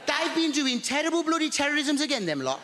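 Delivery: high, fretful Birmingham neighbour; lightly voiced flustered old lady; Maud's voice. Maud voiced in story.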